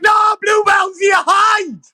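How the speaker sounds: high mock yell telling her to hide; hammed-up high warning yell; mock shout. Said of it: "They'd be like".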